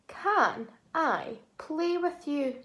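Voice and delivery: usual speaking voice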